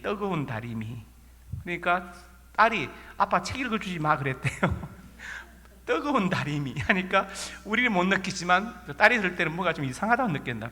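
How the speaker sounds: normal talking voice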